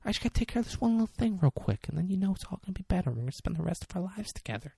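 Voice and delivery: high, soft, rapid sweet-talk. Retold or imitated. Sweet-talking a baby about taking care of things, soft rapid impression. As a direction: coax quickly and quietly, like smooth-talking a partner. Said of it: "Kinda sound like [name] there".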